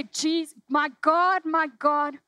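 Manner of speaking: loud, high cry of anguish to God; crying out forsaken. Pretend. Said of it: "when we say".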